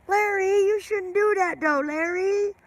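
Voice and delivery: high-pitched scolding wife, mock-distressed; imitating wife yelling. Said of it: "she started yelling at him".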